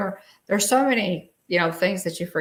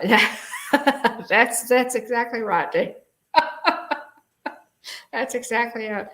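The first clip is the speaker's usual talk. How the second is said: laughing; 5 semitones higher than usual. Real, not acted. Reacting to a slide, laughing.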